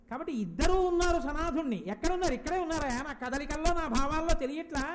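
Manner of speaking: loud, high preaching fervour; fervent declaration. Acted. Preacher fervently declares both parents are here. (ఆవేశంగా గొంతు పెంచి ప్రకటించడం)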